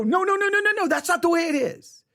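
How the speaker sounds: high, frantic defensive objector; mock protest. Preacher mimicking a defensive churchgoer, high mock protest. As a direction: mimic a panicked churchgoer shutting it down.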